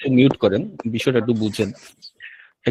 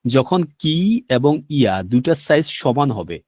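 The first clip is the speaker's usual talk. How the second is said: usual speaking voice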